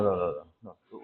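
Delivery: ordinary talk, nothing marked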